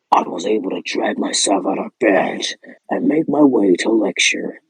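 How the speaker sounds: usual speaking voice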